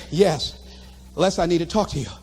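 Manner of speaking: low, sober imitation; subdued girlfriend. Girlfriend needing a talk on the phone, subdued. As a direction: answer his excitement with low seriousness.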